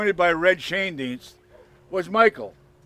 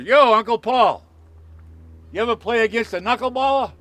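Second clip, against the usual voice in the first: high, loud call across the street; imitated yell; 6 semitones higher, 6 dB louder than usual. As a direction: holler over to your uncle, drawing it out. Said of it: "yells over".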